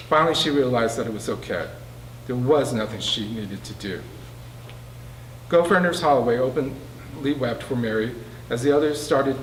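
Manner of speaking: plain reading voice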